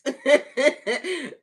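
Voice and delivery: high, loud cackle; real laughing burst. Real, not acted. Host delighted that God don't miss, laughing out loud. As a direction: crack up loudly.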